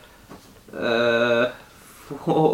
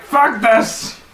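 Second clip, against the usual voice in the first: angry outburst; 7 semitones higher, 4 dB louder than usual. Acted. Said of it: "I was like".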